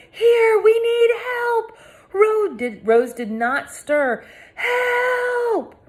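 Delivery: high loud shout for help; crying out. A narrator's reading. Girl hollering to rescuers on the beach. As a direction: holler so they hear you. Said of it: "hollered on a cricket path".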